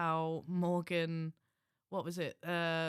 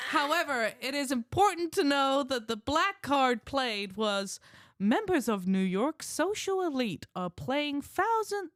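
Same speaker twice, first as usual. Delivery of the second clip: loud, higher-pitched American impression of a wife; American accent; 5 semitones higher, 6 dB louder than usual. Husband reading his wife's comment aloud, put-on American accent. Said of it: "I'll read her comment in her accent".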